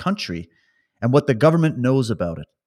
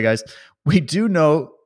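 ordinary talk

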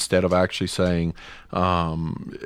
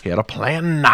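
hammed-up preacher, higher, punching PLAN; mock an old preacher landing hard on PLAN; 5 semitones higher than usual. Podcaster repeating his pulpit parody, old preacher voice. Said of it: "I'm doing old preacher".

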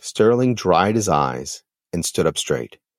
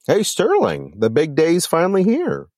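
high, upbeat hello; excited greeting; 9 semitones higher than usual. A narrator's reading. Phil greets Sterling on the wedding day, excitedly. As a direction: greet a pal with lifted pitch and cheer.

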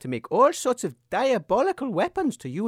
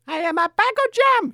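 higher and louder crazed shout; shouting mad queen; 13 semitones higher, 6 dB louder than usual. Acted. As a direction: yell like she's lost her mind. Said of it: "she shouted".

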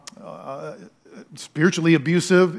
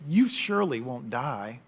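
softer, offhand tempter; casual serpent; 3 semitones lower, 5 dB quieter than usual. Retold or imitated. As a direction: toss it off lightly, like a sly serpent. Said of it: "He says so casually".

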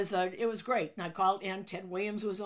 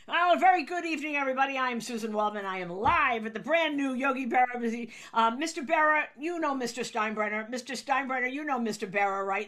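higher, louder on-air announcer voice; broadcaster intro; 4 semitones higher, 5 dB louder than usual. Acted. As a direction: open the live show with a flourish.

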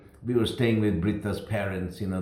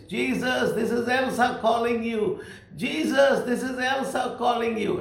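translated shout; 12 semitones higher than usual. Retold or imitated. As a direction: keep calling out to Jesus. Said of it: "And that is".

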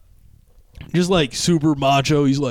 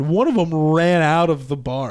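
ordinary talk

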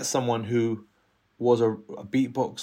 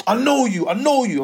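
higher, louder, pointed call; 9 semitones higher, 5 dB louder than usual. Retold or imitated. Ringleader pointing across the estate, a loud high call of recognition.

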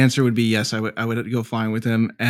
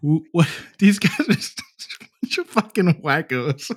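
mock listener; 5 semitones higher, slower than usual. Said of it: "listening to this being like".

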